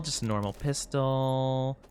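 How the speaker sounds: ordinary delivery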